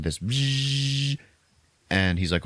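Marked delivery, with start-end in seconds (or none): 0.2-1.2 sustained higher buzzing noise; imitated buzz. Podcaster imitating the approaching ball, a long buzz. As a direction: buzz like a flying metal ball. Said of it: "they hear this sound".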